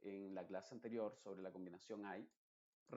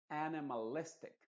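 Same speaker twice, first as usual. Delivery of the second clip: animalistic with AN and LIS both punched; stress on AN and LIS; 4 semitones higher, 5 dB louder than usual. Shown as a demonstration. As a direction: lean on the first syllable and on LIS in animalistic. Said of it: "ahí enfaticé". (animalistic con énfasis en AN y en LIS)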